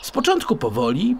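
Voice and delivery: ordinary delivery, nothing marked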